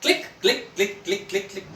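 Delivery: high, staccato camera-shutter clicks; imitated camera clicks. Narrator mimicking the photo session, repeated camera clicks. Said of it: "शुरू हुआ photo session".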